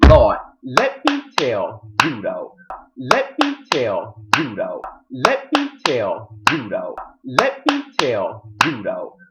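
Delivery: very slow, stretched words; drawn-out emphasis. Real, not acted. Recapper builds up the drama, slow and emphatic. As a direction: drag out each word for the viewers. Emphatic repeated aside to viewers.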